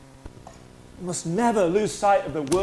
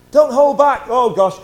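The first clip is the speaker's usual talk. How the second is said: loud, higher call to the room; urging shout; 4 semitones higher, 9 dB louder, slower than usual. Real, not acted. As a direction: cry it out to push them on. Pastor urging congregation loudly.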